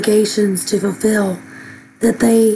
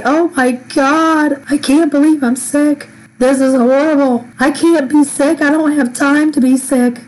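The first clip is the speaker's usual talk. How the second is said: dramatic exasperation; 5 semitones higher, 4 dB louder than usual. Retold or imitated. Retelling her own panic at illness.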